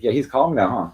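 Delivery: ordinary talk, nothing marked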